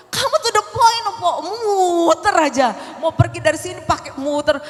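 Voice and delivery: mock nagging wife, high and loud; high-pitched scolding. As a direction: act out a worked-up wife nagging her husband. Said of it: "kalau saya ngomong tuh kayak sekarang ya, cepat. Terus kadang nada tinggi".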